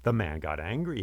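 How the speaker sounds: plain reading voice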